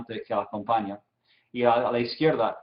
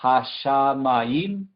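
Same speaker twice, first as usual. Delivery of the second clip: hashamayim very slow, syllable by syllable; drawn-out pronunciation demo; much slower than usual. Shown as a demonstration. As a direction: sound out hashamayim with long, separated syllables. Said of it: "Y después la segunda parte". (hashamayim pronunciado muy lento, sílaba por sílaba)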